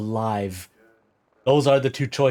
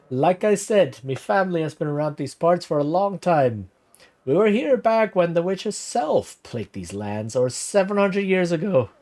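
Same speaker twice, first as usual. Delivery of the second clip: lightly voiced old villager, higher pitch, vaguely Irish; put-on Irish-ish accent; 5 semitones higher than usual. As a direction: spin the legend in a wobbly Irish lilt. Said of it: "went from Italian to, like, something weird to Irish".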